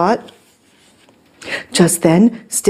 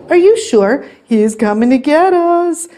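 high-pitched worried storybook voices; alarmed cry; 7 semitones higher than usual. A narrator's reading. Cats alarmed about giant.

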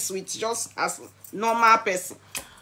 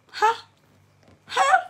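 incredulous huh. Real, not acted. Squeaky disbelief.

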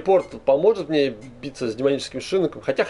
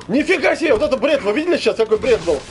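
higher, laughing disbelief; amazed, laughing at the glitch; 7 semitones higher than usual. Real, not acted. Gamer amazed by a game glitch.

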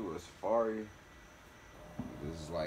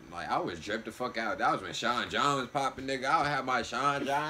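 ordinary talk, nothing marked

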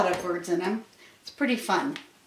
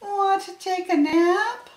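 high-pitched cat question; storybook cat, pitched way up; 10 semitones higher, slower than usual. A narrator's reading. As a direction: ask it as a high-voiced kitty. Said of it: "cried the cat".

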